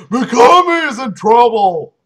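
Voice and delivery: loud, high alarmed shout. Acted. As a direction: yell the warning at full volume. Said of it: "shouted Blood".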